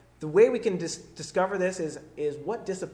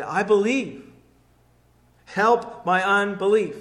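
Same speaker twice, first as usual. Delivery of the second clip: crying out; 2 semitones higher, 7 dB louder, slower than usual. Retold or imitated. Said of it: "he cried out".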